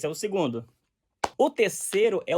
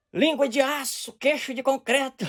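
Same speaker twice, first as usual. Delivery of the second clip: high, loud, slow fiery preacher voice; mock fire-and-brimstone preacher; Marco Feliciano impression; 8 semitones higher, 4 dB louder, slower than usual. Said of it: "pregando igual o Marco Feliciano".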